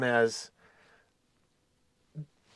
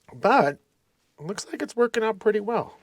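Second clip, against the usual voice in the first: ordinary talk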